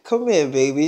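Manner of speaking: low, soft sweet-talk; retold soothing, dropped low and quiet; coaxing boyfriend. Boyfriend coaxing his upset girlfriend.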